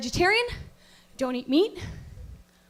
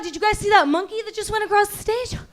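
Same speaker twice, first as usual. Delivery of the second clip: ordinary talk, nothing marked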